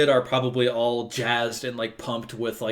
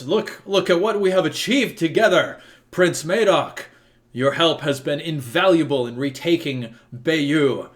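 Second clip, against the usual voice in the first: loud and high, rallying the crowd; proud victory call across the square; shouting; 5 semitones higher, 7 dB louder than usual. Acted. Praetor shouting to the prince before the crowd, loud and proud. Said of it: "he shouts".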